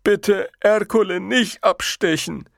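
slow, high, pleading simpleton; high, drawn-out whimper; whimpering giant. A narrator's reading. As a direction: beg like a frightened oaf. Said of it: "wimmerte der Riese".

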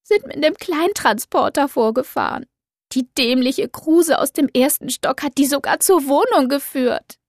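high, tearful complaining; indignant and near tears; upset young woman. Acted. Doro upset, voiced by narrator.

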